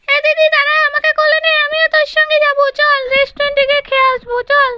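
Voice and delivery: put-on high, childish pleading little brother; little boy voice. Little brother begging big sister to wait, childish high voice. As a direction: squeak it out like a small boy begging his sister.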